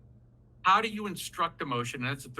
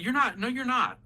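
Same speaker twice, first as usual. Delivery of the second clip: insisting father; 8 semitones higher, 4 dB louder than usual. Retold or imitated. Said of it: "I would tell him".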